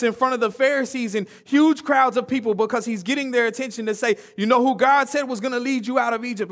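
energetic preacher voice; preaching. Acted. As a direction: press the point home with fervor. Preaching build.

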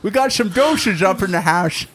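toothless. Pretend. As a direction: talk like your dentures are out. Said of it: "Let, let me put in my dentures".

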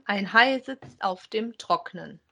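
ordinary delivery

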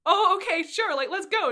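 high, loud, suddenly eager imitation; mock surprised agreement. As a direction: give in all at once, big and loud. Retold Heather suddenly agreeing.

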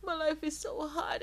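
high whiny self-pity; hammed-up whine about hardship; mock whining. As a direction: parody a complainer. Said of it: "I'm like".

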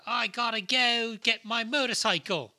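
put-on loud, high, terrible American accent; Charlie Hunnam. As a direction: overdo a British actor's botched American accent. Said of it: "he sounded just like that".

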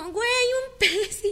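high, slow, drawn-out thrill; excited discovery. Retold or imitated. Friend in the sea thinking she found a fish, excited. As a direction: call it out like you just spotted a fish. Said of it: "Y entonces dijo".

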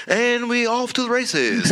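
high, slow hammed-up race call; a hint of a hyped sports announcer opening; sportscaster voice. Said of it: "that's not my sportscaster voice".

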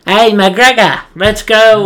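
high, loud call to a buddy. Retold or imitated. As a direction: shout it like a soldier in the game. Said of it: "he's not as raspy".

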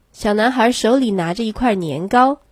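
ordinary delivery, nothing marked

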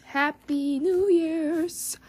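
slow, lilting happy new year's to the listeners; drawn-out greeting. Real, not acted. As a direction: draw the greeting out like a little tune. New year greeting, stretched sing-song repeat.

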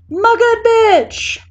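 high, loud, drawn-out holler; bellowing. A narrator's reading. Bursting into sister's tattoo studio, bellowing. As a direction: bellow a greeting across the room. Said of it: "throws open the door ... and bellows".

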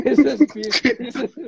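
high laughing words. Real, not acted. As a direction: say it through laughter.

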